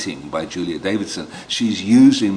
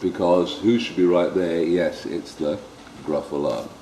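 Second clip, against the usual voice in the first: plain reading voice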